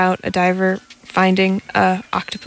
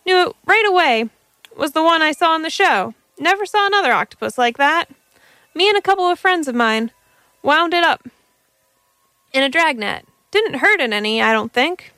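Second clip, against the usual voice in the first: lightly voiced young man, higher; eager young man, a touch higher; 7 semitones higher, faster than usual. Young man telling his find.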